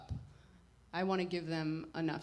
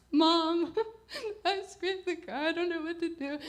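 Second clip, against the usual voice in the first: high, half-hearted mock sobbing plea; retold crying call; 9 semitones higher, 3 dB louder than usual. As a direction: replay a panicked teary phone call, mildly. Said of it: "I call my mom crying".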